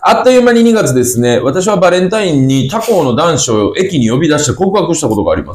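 upbeat, high-pitched radio read; high-energy letter reading. Real, not acted. Radio host reading a Valentine letter to the co-host, high-energy. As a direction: launch brightly into the listener's letter. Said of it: "そんなにテンションで読んでなかったけどな".

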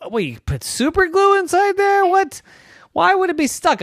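high, loud disbelieving questions; incredulous coach. Acted. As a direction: coach can't believe his ears.

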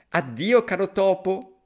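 panicked fox. Acted. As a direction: blurt goodbye and flee. Fox bolts in one breath.

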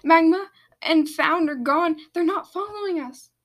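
high, alarmed call to the group; shouted. A narrator's reading. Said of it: "turned to everyone and shouted".

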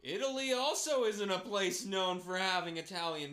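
hammed-up indignant declaration; mock insistence. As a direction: shout the absurd claim with conviction. Mock argument over where Italians exist.

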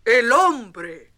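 loud, grave alarm; terrible warning. Acted. The great stag warns the herd, loud. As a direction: sound the alarm. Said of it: "dijo una terrible palabra".

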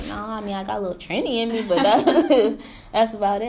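fast, laughing reply; lightly laughing, quick self-quote. Retold or imitated. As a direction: laugh through the answer. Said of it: "I'm like".

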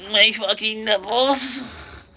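high, slow, stretched squeal; mock squeal. Punchline for Brent, high slow squeal. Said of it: "And I'm like".

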